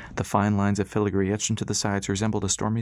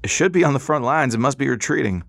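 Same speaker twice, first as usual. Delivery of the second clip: put-on raised, urgent old man; 5 semitones higher, 5 dB louder than usual. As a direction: call it out with worry. Pop worried about the ship.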